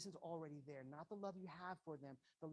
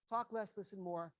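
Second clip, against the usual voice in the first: louder, punchy "talk less, listen more"; emphatic slogan; 3 semitones higher, 7 dB louder than usual. Shown as a demonstration. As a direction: drive the motto home with force. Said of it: "I can't say that one enough".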